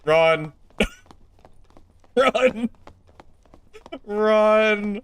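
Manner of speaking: high, loud cries of run; panicked shouting. Pretend. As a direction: yell it like fleeing in terror. Mock panic, fleeing the sorcerer.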